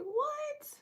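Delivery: very high, stretched-out surprised squeal; retold astonishment. Learning they share a college, acted high-pitched astonishment.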